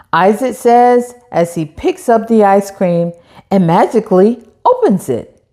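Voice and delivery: plain reading voice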